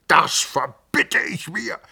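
a slow, high, loud furious cry; shouting. A narrator's reading. The Hofrat screams at the cousins, slow furious shout. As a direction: bellow it out in outrage. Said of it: "schrie er".

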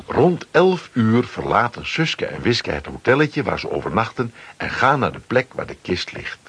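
usual speaking voice

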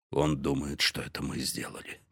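breathless, near-whispered; gasping, barely voiced; gasping for air. A narrator's reading. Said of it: "задыхаясь".

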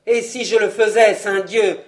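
s'écrier, très fort, furieux; il éclate de colère contre sa fille; vraiment en colère. Acted. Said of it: "s'écria Vaunois véritablement en colère". (le père défie sa fille, en criant de colère)

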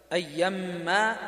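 أيما drawn out very slowly; slow recitation. Shown as a demonstration. Teacher spelling out the word for students, very slowly. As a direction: stretch every sound of the word. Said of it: "وقف حمزة الكسائي بالألف على لفظ".

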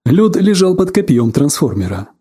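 ordinary delivery, nothing marked

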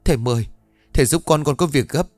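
high-pitched, pressing appeal; urgent pleading. A narrator's reading. Mother begging the spirit master for help, urgent pleading. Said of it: "với một thái độ hết sức gấp gáp".

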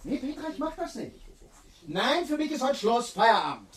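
high-pitched, exasperated refusal; indignant refusal. Acted. Speaker telling Petra the session is over, indignant refusal.

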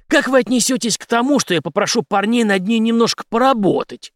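high, forceful veiled threat; hard, taunting. A narrator's reading. Henry threatening the major's cousin, hard and taunting. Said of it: "жёстко усмехнулся Генри".

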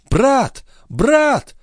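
very high-pitched calls to a brother; crying out. A narrator's reading. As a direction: cry out high and excited, twice. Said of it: "вскричала Андреа". (взволнованный высокий вскрик)

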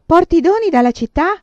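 high, loud, nosy neighbour; shouted question. A narrator's reading. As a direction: shout the question across the room. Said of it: "gridò un'altra". (domanda gridata, forte e acuta)

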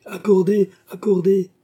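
loud, high eager cry; exclaiming. A narrator's reading. The king grants Suffren's nephew pardon, loud high cry. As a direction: burst out granting the pardon at once. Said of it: "s'écria le roi".

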